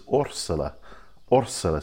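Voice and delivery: Orsala stressed on the first O, with an S sound; correct local pronunciation. Shown as a demonstration. Guide teaching readers a village name, correctly pronounced. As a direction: say Orsala as OR-sa-la, soft S for the Z. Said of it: "the correct pronunciation".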